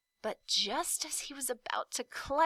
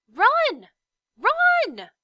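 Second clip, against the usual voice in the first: shouted high and very loud; a desperate shout of warning; crying out; 15 dB louder than usual. A narrator's reading. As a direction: yell a warning to flee. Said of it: "it urged once more".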